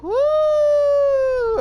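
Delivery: long, held falsetto whoop; loud sustained high woo. Acted. Prisoner starting to dig with a spoon, whooping high. Prisoner digging out in comic tale.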